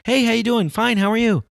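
high, bright mock-polite greeting and reply; fake cheery small talk. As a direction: chirp the empty pleasantries, hammed-up. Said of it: "People just go".

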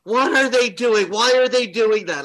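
high, loud mock scream; screaming mom. Mom screaming at the TV over the play, high mock scream. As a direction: shriek it at the TV like a panicked fan. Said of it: "she'd be screaming like".

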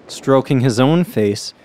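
ordinary delivery

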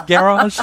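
garage said GA-rahzh, stressed on the first syllable; British garage pronunciation. Shown as a demonstration. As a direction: hit the front of garage, "GA-rahzh". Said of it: "what I've heard a lot of Brits say".

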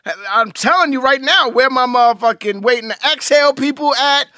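high, loud hype-man calls to his fans. Pretend. Calling out to his fans as a loud hype man.

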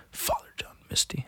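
whispered name, under the breath; mocking whisper. Real, not acted. Scoffing at a singer he dislikes, mocking whisper. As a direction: whisper it in disdain.

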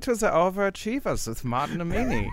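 high, hammed-up German announcer voice; podcast host asks for a plug, guest answers in a high, hammed-up German accent. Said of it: "It's a German accent".

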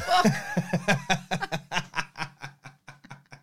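long burst of real laughter. Real, not acted. Reacting to the subscriber tattoo joke, long laugh. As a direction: keep laughing helplessly.

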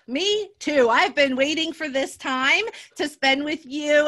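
usual speaking voice

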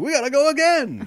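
high, loud, thrilled; excited shout. Retold or imitated. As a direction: shout it with glee. Excited roller coaster quote.

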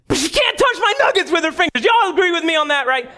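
pastor rallying the congregation behind him; emphatic shout. Real, not acted. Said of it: "still heated, appealing to congregation".

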